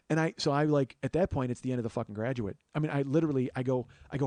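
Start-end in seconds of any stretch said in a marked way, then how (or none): none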